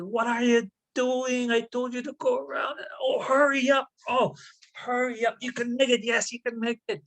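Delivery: high-pitched frantic calls across the ice; frantic calling out. Acted. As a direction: shout him on, panicked, from the far shore. Wene Boju shouting to wolf across ice.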